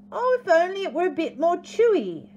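high, theatrical wishful sigh; hammed-up sigh, high and loud. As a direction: lament it like a dramatic emu. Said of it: "she sighed".

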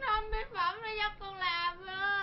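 crying. Acted. Mother crying over daughter.

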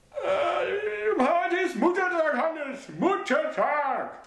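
high mother's voice, breaking into a wail. A narrator's reading. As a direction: wail about the forgotten day.

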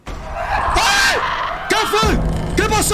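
high shriek then alarmed questions; startled shriek. Real, not acted. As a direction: jump at a sudden scare. Sudden scare in game.

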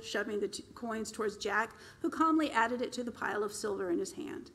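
usual speaking voice

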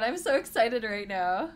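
normal talking voice

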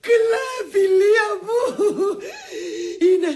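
high, wailing sobs; hammed-up tearful wail; crying. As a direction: sob out the terrible secret haltingly. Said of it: "τι έχεις, γιατί κλαις".

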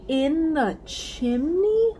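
high, loud and drawn-out disbelief; incredulous question. Acted. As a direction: question it in slow astonishment. Lucy astonished by chimney delivery.

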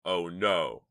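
loud cry of dismay; alarmed cry. A narrator's reading. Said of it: "cried Chuck".

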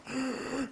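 soft gasp for air; mock dying gasp. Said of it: "I'm gonna be laying there going".

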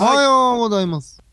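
very high, loud, stretched good morning; loud morning greeting. Pretend. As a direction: belt out a long wake-up reply. Answers the in-game wake-up greeting. (高い大声で伸ばす朝の挨拶)

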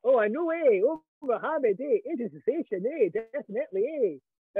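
hammed-up Fife accent, punching every eh. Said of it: "saying the names of venues in Scotland in a Fife accent".

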